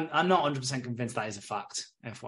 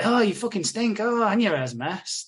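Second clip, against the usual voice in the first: higher-pitched mock disgust; mock teasing; 7 semitones higher, 4 dB louder than usual. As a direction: imitate mean boys jeering at a girl. Boys teasing younger girl.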